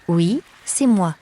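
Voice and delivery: slow resigned reply. Acted. Captive answering the new guard, slow and loud. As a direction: drag it out, defeated.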